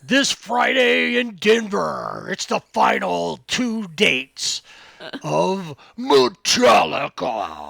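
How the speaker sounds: slow booming monster truck announcer. Shown as a demonstration. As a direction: roar it like a truck rally ad. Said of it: "in a monster truck voice".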